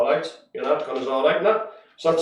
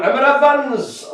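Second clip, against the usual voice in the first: put-on Emma Evans in a slow, sing-song higher Welsh accent; 9 semitones higher, 5 dB louder than usual. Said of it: "I have to say Emma Evans in a Welsh accent".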